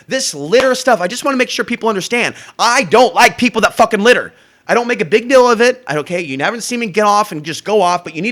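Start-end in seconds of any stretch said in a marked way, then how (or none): 2.6-5.8 raised, louder angry outburst; heated, higher and louder; angry rant. Real, not acted. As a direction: let the anger about littering boil over. Ranting about people who litter.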